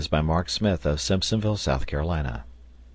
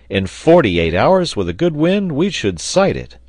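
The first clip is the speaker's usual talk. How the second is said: loud, high, hearty sailor; raised voice, sure of a quick passage; confident Pencroft; 8 semitones higher, 6 dB louder than usual. A narrator's reading. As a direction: boast it with eager confidence.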